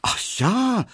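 a suitor's overjoyed exclamation; delighted cry. A narrator's reading. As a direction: cry out in happy relief. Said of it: "rief Emil Kubinke".

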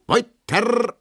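dance master calling out to the hall to continue. A narrator's reading. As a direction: call the dancers back onto the floor. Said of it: "ruft der Tanzmeister".

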